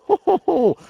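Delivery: a jolly, hearty "ho, ho, ho" laugh; tickled hearty laugh; hearty chuckle. Acted. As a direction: chuckle with delight at the gadget. Chuckling at his bed lever.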